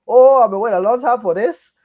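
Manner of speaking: mock Foghorn Leghorn meets Jamaican accent, giggling; Gambit's bizarre accent. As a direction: ham up a cartoonish southern-Caribbean swagger, cracking up. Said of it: "like a Jamaican and Foghorn Leghorn had a child".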